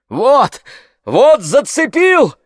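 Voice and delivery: high, loud, excited yell; sudden shout. A narrator's reading. Coachman hooks something in the pond, shouting. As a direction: cry out at a sudden catch. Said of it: "закричал вдруг кучер".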